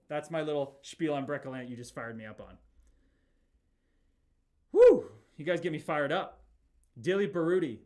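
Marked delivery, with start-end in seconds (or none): 4.7-5.1 a huge, high, loud whoop; excited whoop. Real, not acted. Streamer whoops at the chat, all fired up. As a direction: let out a fired-up woo.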